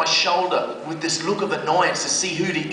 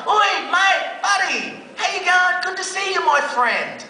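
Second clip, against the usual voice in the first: much higher, over-friendly greeting; chummy youth worker; 10 semitones higher than usual. Retold or imitated. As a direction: lay on the matey charm.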